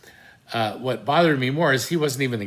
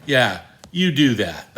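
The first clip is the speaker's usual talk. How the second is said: louder sarcastic jeer; mocking taunt; 3 semitones higher, 8 dB louder than usual. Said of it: "they kinda went like".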